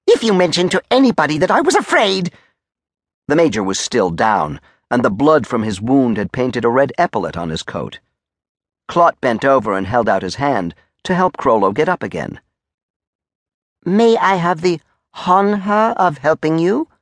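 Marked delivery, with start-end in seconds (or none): 0.1-2.3 high-pitched blustering threat; threatening. A narrator's reading. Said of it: "pointed threateningly at Clot". 13.8-16.9 high, over-polite offer; soldier Clot. Acted. Soldier offers the Major a hand, high and over-polite.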